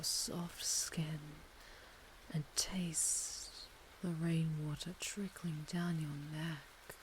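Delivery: soft, breathy whisper close to the ear; sensual whisper. Acted. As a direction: whisper it like a caress. Slow sensual caress whispered close.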